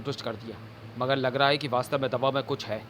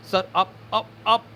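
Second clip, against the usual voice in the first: panicked stammer; 7 semitones higher, 3 dB louder than usual. Acted. Collapsing compounder stammers.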